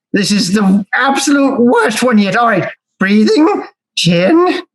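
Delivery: high, strained, counting to calm down; fighting for calm, one number at a time; exasperated counting. Acted. Foe counting to calm himself.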